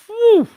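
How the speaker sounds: sharp loud whistle-like exhale; loud high whew. Real, not acted. Reacting to a wild price spike.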